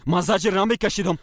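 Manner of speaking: shouted high and loud in denial; angry shout. Acted. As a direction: throw the denial at her. Husband and wife quarrel.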